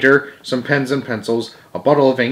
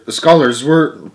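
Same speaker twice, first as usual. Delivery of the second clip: ordinary delivery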